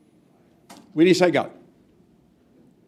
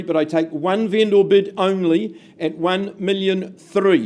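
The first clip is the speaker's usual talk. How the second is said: ordinary talk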